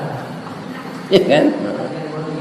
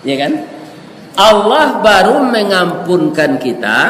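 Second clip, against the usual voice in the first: usual speaking voice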